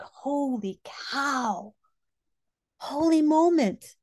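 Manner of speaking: slow, drawn-out amazement, then a high loud cry; amazed exclaim. Retold or imitated. Seeing her sister move her arm, amazed and high. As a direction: stretch the wonder, then burst up. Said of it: "And I was like".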